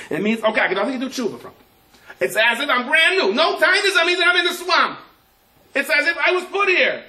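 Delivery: high, loud, building excitement; rising fervour. Real, not acted. Preacher telling the congregation, rising high and loud.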